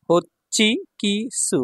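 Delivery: হচ্চি কি সু said slowly, syllable by syllable; slow model word. Shown as a demonstration. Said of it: "teacher models word slowly".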